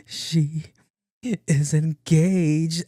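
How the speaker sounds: high, drawn-out mock wail; imitated crying. Friend breaks the engagement news, mock crying. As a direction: wail it slowly through put-on sobs. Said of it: "Called me crying".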